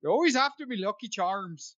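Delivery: high-started Irish accent, a bit Scottish; a modest Irish accent try. Shown as a demonstration. Pushed to try, a modest high-started Irish accent. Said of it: "give it a shot now".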